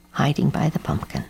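usual speaking voice

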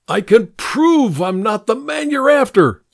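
a trapped man shouting his innocence at the gunman; desperate shout. A narrator's reading. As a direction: cry it out to be heard over the threat. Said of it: "I shouted".

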